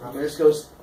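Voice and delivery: ordinary delivery, nothing marked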